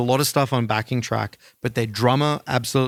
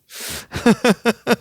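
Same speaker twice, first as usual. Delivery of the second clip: high burst of laughter; 11 semitones higher, 3 dB louder than usual. Real, not acted. Joking that bad songs win with delay, high laugh. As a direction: laugh at your own joke. Laughs at his own joke.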